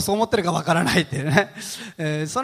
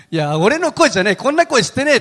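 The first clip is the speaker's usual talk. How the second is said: re-enacted protest, louder and climbing high; stubborn denial; 6 semitones higher, 6 dB louder than usual. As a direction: insist louder, as if retelling an argument. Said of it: "めっちゃ言い張ったんですけど".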